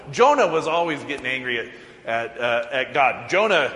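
normal talking voice

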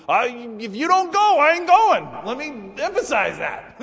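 very high, loud, worked-up insistence; furious shouting ultimatum; lathered-up Moses. Acted. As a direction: yell it out, fully lathered up. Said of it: "Moses is so lathered up".

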